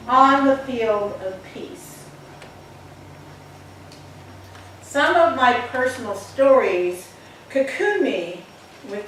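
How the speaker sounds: measured recitation, each image given weight; poem reading. Acted. Presenting Rebecca's poem to listeners, earnest recitation. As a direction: read it like a poem on stage, pausing between phrases.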